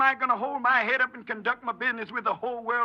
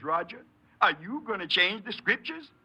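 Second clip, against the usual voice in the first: ordinary talk, nothing marked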